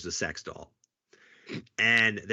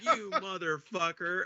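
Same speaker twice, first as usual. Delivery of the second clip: slow, higher accusing drawl; mock outrage; 8 semitones higher, slower than usual. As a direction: drag it out at the prankster, half laughing. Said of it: "And he's just like".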